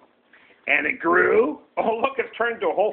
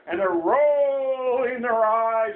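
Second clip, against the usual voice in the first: higher, "rolling" stretched out slowly; drawn-out dramatic reading; 5 semitones higher, much slower than usual. A narrator's reading. Parent narrating the monsters' antics, "rolling" drawn out. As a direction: stretch the word "rolling" long.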